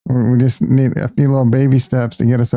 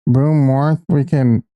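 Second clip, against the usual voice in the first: normal talking voice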